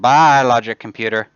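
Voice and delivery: a big, loud send-off; loud farewell. Real, not acted. Streamer tossing a broken computer away, loud farewell. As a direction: call goodbye loudly, hitting BYE hard.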